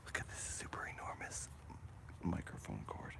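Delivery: soft unvoiced whisper. Real, not acted. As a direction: whisper so nobody wakes. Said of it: "I'm going to whisper so I don't wake up the neighbors".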